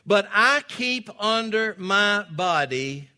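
loud, high preacher's exhortation; full-force preaching. Acted. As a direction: drive each point home to the congregation.

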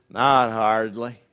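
slow, loud and drawn-out cowboy line; John Wayne. Retold or imitated. Preacher quoting the Duke, slow loud drawl. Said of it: "Remember what Duke said?".

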